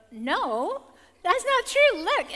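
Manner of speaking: high, loud mock contradiction; insistent correcting. Correcting her sister after googling it, mock insistent.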